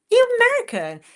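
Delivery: high, loud mock amazement; astonished. Storyteller reacting to meeting an American in Swindon, astonished.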